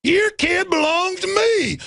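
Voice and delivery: high, slow sneering bureaucrat; sneering high parody of a cop; mocking cop voice. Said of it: "He's like".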